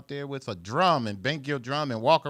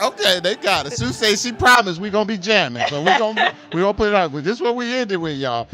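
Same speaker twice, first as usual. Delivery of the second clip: much louder and higher, talking over the closing music; 7 semitones higher, 13 dB louder than usual. Real, not acted. Host signing off to listeners over music, loud and laughing. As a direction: call it out above the song.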